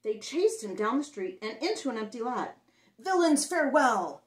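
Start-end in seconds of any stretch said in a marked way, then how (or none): 3.0-4.2 loud, higher howl of a farewell; boy howling a bold goodbye to bullies. Acted. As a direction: howl it back over your shoulder. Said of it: "howled Patrick Edward".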